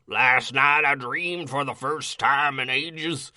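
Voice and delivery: slow, strained monster voice; strained demon reading voice. Acted. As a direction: read it as a rough demon. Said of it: "That hurts, that hurts my voice".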